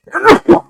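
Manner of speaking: sharp real cough. Real, not acted. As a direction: cough once, loud and sudden. Excuse me.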